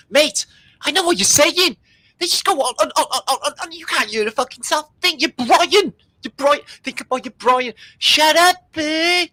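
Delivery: high, squeaky ranting voice; put-on character. Ranting character complaining to his mate, high and squeaky. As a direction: rant like a wound-up little bloke.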